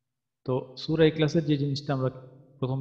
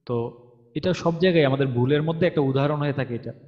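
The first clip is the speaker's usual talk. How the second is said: ordinary talk, nothing marked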